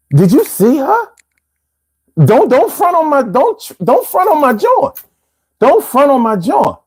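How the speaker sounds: high-pitched playful protest; mock indignation. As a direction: defend your girl in mock outrage. Mock defence of his shorty.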